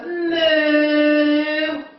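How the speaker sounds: long, sustained imitated moo; cow moo. Shown as a demonstration. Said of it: "and go".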